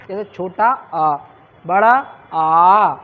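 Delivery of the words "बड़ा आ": आ held long and louder; long vowel demo. Shown as a demonstration. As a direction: draw out आ to double length. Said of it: "दीर्घ स्वर दो गुना". (आ को दो गुना लंबा बोलें)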